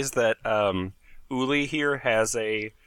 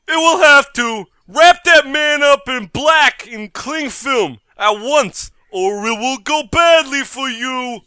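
bank robber; 8 semitones higher, 10 dB louder, slower than usual. Acted. Robber threatens.

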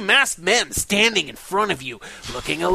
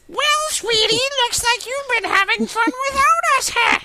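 Wicked Witch of the West. Acted. Reader voices the taller intruder like the Wicked Witch. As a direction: sneer like a storybook witch. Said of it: "this is the Wicked Witch of the West".

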